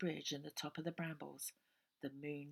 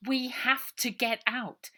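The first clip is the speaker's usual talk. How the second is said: urgent; 7 semitones higher, 11 dB louder, slower than usual. A narrator's reading. Ida urgent to escape.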